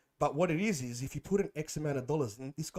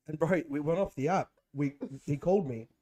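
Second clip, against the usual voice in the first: normal talking voice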